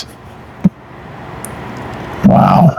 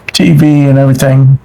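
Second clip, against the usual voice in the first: ordinary talk, nothing marked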